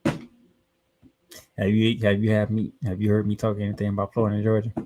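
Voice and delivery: ordinary delivery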